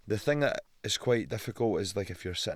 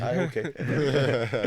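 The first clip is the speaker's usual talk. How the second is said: amused agreement; 4 semitones higher, 7 dB louder, much slower than usual. Retold or imitated. Answering daughter's fight request.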